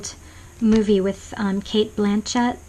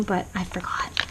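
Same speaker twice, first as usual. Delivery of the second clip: unvoiced sheepish whisper; 7 dB quieter than usual. Real, not acted. Whispering to viewers that she forgot the phrases. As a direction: confess it under your breath.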